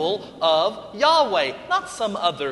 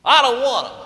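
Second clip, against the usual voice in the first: refusing; 6 semitones higher, 8 dB louder than usual. Acted. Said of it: "played hot potato back and forth".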